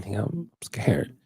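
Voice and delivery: hushed, slowed whisper of a confession; a nervous man whispers his fear to his friend; scared whisper. Retold or imitated. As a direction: whisper it like you're scared to admit it. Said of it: "I said, just like that".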